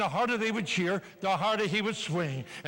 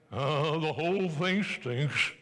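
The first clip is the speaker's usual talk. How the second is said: lightly voiced sulky grump, slow and drawn out; grumbling skeptic; 4 semitones lower, much slower than usual. Speaker mocking a sulky audience member, a light slow grumble. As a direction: drag the sour complaint out slowly. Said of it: "one thumbsucker sitting in every group thinking".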